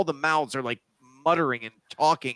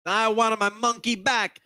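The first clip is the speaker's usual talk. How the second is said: Italian villain; 10 semitones higher than usual. Acted. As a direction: snarl it like a cartoon Italian mobster. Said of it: "it's Stromboli".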